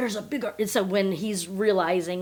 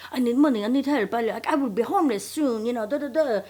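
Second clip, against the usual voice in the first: put-on higher, louder plea in a French accent; 4 semitones higher, 3 dB louder than usual. Swindler asking third woman for money, in mock French accent. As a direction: beg in a rough French accent. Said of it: "I make him have a French accent".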